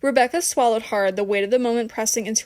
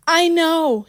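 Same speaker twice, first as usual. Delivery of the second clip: higher, louder forced-out admission; thick with regret; 7 semitones higher, 5 dB louder than usual. A narrator's reading. Rebecca forces out an admission to Michelle, regretful. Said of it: "her voice thick with regret".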